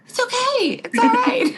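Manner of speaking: very high, sing-song comfort; mock consoling mom. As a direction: coo it way up like a cheery team mom. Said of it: "And I would be like".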